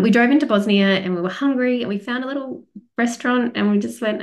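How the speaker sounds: usual speaking voice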